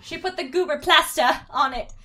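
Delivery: high, loud, British "plaster"; British accent on plaster. Acted. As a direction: insist loudly, with a mock-British plaster. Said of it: "say plaster in a British accent".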